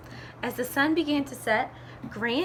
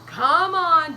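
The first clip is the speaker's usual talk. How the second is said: loud, higher call; Mister Gumpy inviting; 4 semitones higher, 9 dB louder than usual. Acted. Mister Gumpy lets Dog aboard, loud invitation. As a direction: call the passenger aboard loudly. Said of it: "Mister Gumpy said".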